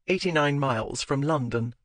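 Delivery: usual speaking voice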